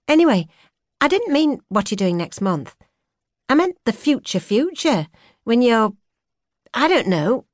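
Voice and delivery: put-on young woman musing aloud; young woman's voice. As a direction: voice her bright and searching. She voiced higher as narrator.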